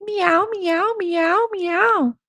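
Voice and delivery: playful string of high meows; cat meows. Pretend. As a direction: run of high kitten meows. Reading out the cat sounds.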